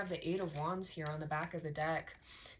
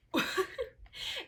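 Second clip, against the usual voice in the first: high breathy laugh; 12 semitones higher than usual. Real, not acted. Laughs at misreading.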